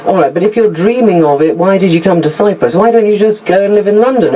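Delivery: ordinary delivery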